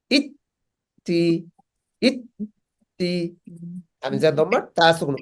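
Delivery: normal talking voice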